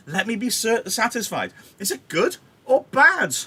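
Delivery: high, loud, insistent demand; exasperated Juliet. Acted. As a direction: press hard for a straight answer. Juliet demands news from nurse.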